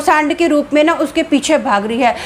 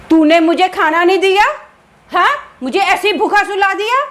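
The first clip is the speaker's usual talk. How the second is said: angry husband; 6 semitones higher than usual. A narrator's reading. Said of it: "गुस्से से उठता है और अपनी बीवी को बोलता है".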